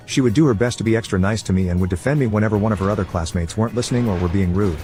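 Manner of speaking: plain reading voice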